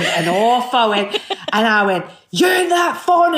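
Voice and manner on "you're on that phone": high, loud telling-off yell; angry shout. Retold or imitated. Mother yelling at her daughter in front of the teachers. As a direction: bellow it at her in a fury.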